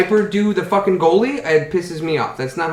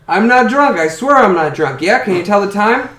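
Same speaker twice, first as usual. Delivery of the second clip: put-on drunk clock voice; 6 semitones higher, 6 dB louder than usual. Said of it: "there's a clock on the wall and it goes".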